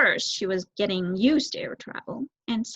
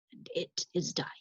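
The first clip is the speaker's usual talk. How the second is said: whispered and mournful; sad whisper; 3 semitones lower, 10 dB quieter than usual. Acted. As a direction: let it fade to a whisper. Owl's sad confession.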